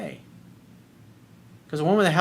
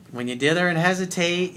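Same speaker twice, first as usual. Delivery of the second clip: normal talking voice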